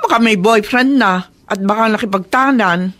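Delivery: normal talking voice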